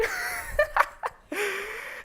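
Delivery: loud, high burst of laughter; big laugh. Acted. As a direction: laugh long at your own joke. Laughing at own tale.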